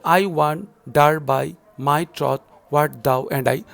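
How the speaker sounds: slow, loud, stressed beats; scanning aloud. Shown as a demonstration. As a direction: beat out the iambs slowly. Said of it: "five meters, so pentameter, and of course iambic".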